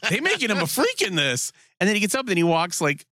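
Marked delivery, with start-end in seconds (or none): 0.1-1.5 high-pitched mock-amazed reaction; acted amazement. Podcaster retells his first reaction to the show, amazed. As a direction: let a retold "whoa" moment jump up high.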